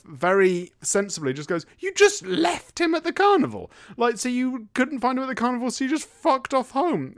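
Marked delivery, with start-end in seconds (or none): 1.8-7.1 high, louder furious mock scolding; wife berating her husband in an angry mock voice. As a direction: tear into him like a fed-up wife. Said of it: "And she's really angry at him".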